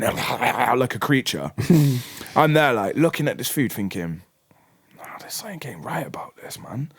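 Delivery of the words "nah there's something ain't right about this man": soft suspicious whisper to yourself; hushed private doubt, barely voiced; whispered thought. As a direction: whisper it like you don't trust the plate. Suspicious thought while eyeing food.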